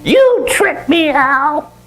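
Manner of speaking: high, slow, aggrieved monkey voice; indignant squeaky monkey; unhappy monkey. Acted. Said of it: "Not a happy monkey".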